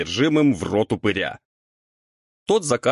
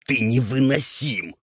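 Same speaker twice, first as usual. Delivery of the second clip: higher raspy ghoul, lightly hissed; fed-up hiss through a rasp; Roul hissing; 5 semitones higher than usual. A narrator's reading. Ghoul gives up on stubborn devil, hissing. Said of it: "зашипел на него Роуль".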